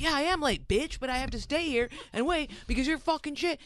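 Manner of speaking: high-pitched exasperated snapback; retold angry comeback. Airport traveler firing back at a needling kid, retold indignantly.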